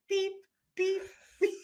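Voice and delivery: high imitated beeps; high mock warning beeps; mock beeping. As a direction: beep like an electronic alarm. Imitating the bracelet's warning beep.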